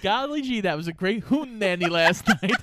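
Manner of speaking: high, laughing gee-whiz voice; chirpy old-fashioned kid, laughing; wholesome 1950s kid. Pretend. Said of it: "Use it in a sentence, please".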